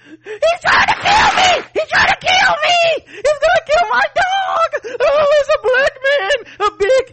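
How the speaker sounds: high crying panicked screams; hysterical Karen. Retold or imitated. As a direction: wail for help in fake panic. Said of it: "Karen at the park".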